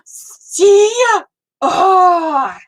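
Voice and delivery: sharp gasp, then a high, loud see; real thrilled gasp and cry; cheering a friend's stitching progress; excited gasp. Real, not acted.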